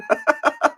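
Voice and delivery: high cackling laugh; real burst of amused cackling. Real, not acted. Host joking about selling adult underwear, cackling laugh.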